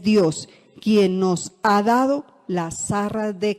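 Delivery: solemn loud Bible reading; scripture reading. Acted. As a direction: proclaim it to the congregation. Reads scripture aloud.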